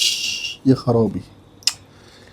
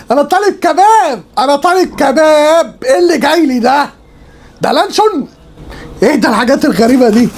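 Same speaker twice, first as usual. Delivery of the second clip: high, indignant disbelief; shocked outrage; 9 semitones higher than usual. Real, not acted. Food vlogger shocked at kebab from Darwish, high indignant protest. Repeats complaint, even higher.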